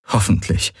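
ordinary delivery

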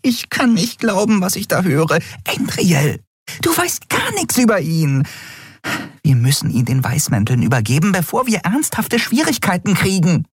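high-pitched, exasperated scolding; worked-up squeaky character voice; agitated Nelen. A narrator's reading. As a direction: argue urgently in a high voice.

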